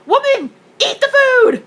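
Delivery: high, slow exasperated shout; exasperated yell. Retold or imitated. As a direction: yell at her to just eat. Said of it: "I'm like".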